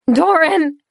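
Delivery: high, loud scream; girl screaming at her freezing friend; screaming his name. Acted. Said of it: "She screamed his name, her voice breaking".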